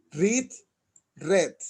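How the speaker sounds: "Read" as reed, then "read" as red; present then past. Shown as a demonstration. Teacher showing students read present then past, reed then red. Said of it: "En pasado se pronuncia como el color".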